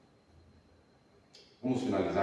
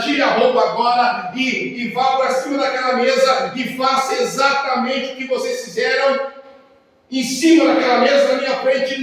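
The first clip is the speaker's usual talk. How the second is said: commanding shout; 11 semitones higher, 9 dB louder than usual. Retold or imitated.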